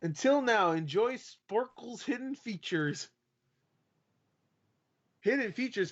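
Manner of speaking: high, slow announcer read. Pretend. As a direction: read it like a hammy ad. Reading quiz page in put-on voice.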